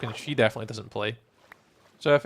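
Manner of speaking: ordinary talk, nothing marked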